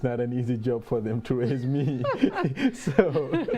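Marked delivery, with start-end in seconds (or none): 2.1-2.7 high, bright laugh; burst of laughter. Real, not acted. Host laughing at his own joke about parents, high. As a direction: laugh at himself for still being raised.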